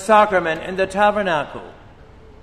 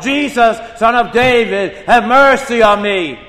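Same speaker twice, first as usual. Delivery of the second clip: loud, higher desperate plea; desperate beggar shouting for mercy; loud cry; 6 semitones higher, 8 dB louder than usual. Retold or imitated. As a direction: cry out with all your strength. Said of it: "the louder he cried".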